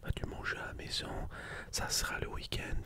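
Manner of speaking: usual speaking voice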